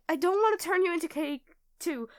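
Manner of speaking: ordinary delivery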